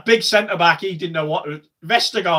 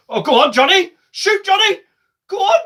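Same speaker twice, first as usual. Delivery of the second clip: urging shout; 6 dB louder than usual. Real, not acted. Cheering a shot on goal.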